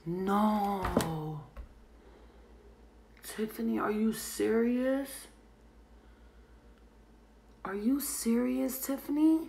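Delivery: slow, drawn-out dismay, pitch climbing; stunned at bad news, questions stretched upward; real disbelief, high and pleading for it not to be true; real shocked disbelief. Real, not acted. Reacting to news of death.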